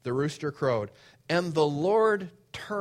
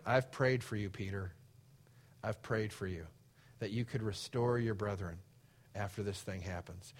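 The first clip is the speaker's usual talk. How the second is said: tender reassurance; 6 semitones lower, 8 dB quieter than usual. Acted. Jesus reassuring Peter, voiced softly.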